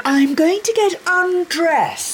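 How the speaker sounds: slow, high, loud sing-out; teasing call. Acted. Wife luring husband off the phone, teasing call. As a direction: call it out to distract him.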